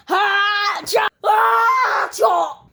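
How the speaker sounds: high, sharp "ha-cha" yells; sudden shouts. Acted. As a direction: burst out in two high shouted cries. Shouting attempt to cure hiccups.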